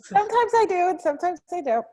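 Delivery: playful. Real, not acted. Playful reply to teasing.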